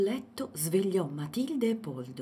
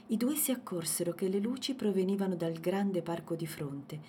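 ordinary delivery

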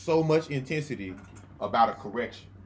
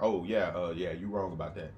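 quiet friend voice; 8 semitones lower than usual. Retold or imitated. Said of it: "he's like".

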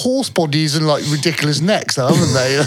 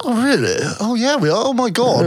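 lightly posh, raised pitch; a touch of a posh lady, pitched up; 6 semitones higher than usual. Acted. Friend teasing the podcast ending, in a light posh voice. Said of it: "Why have you gone so posh?".

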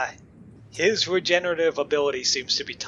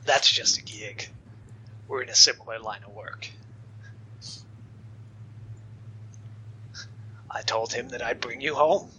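low villain voice, fading toward a whisper; low-pitched hired villain, matter-of-fact menace; cool menacing villain; 4 semitones lower, 7 dB quieter than usual. Acted. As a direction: deliver the threat low and unhurried, with pauses. Game master plays the villain in dialogue.

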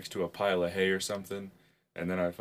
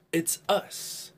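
high, drawn-out show greeting; hammed-up intro; 9 semitones higher, much slower than usual. Podcast host greeting the listeners, hammed-up intro. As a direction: stretch out a mock-grand announcement.

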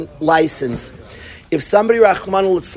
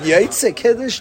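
high-pitched, exasperated echo question; retold incredulity; 7 semitones higher, slower than usual. Student to partner worried about Kiddush, incredulous. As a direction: throw his question back in disbelief. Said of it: "I was ready, like".

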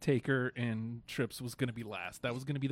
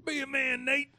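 loud, high Southern holler; Southern accent yelled out; southern drawl; 13 semitones higher, 6 dB louder than usual. Pretend. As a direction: belt it like a good ol' boy. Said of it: "progressively more southern".

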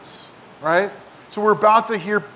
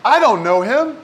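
mild denial; 6 semitones higher, 5 dB louder than usual. Retold or imitated. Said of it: "he denied it, saying".

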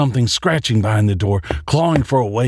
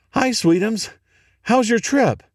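high, sweet motherly voice; mother on the phone; 13 semitones higher than usual. Acted. Mother calls daughter on the hotel phone, high sweet motherly voice. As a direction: coo it like a doting mom. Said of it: "My mom is calling me?".

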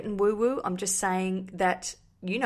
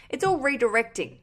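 ordinary talk, nothing marked

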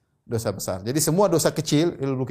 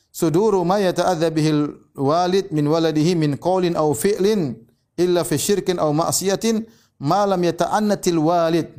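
Arabic text recitation; 3 semitones higher, 3 dB louder than usual. Acted. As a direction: recite the classical text with care. Reads Arabic text of Ibn Hajar.

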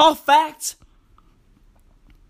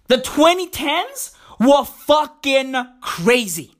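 ordinary talk

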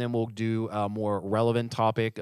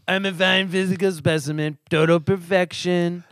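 high, loud put-on braggart voice; drawn-out, over-the-top self-praise; mock boast; 6 semitones higher, 6 dB louder than usual. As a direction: proclaim it with hammed-up pride.

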